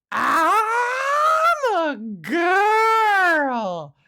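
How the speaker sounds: high, loud mock-crying wail. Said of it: "I would say it mockingly".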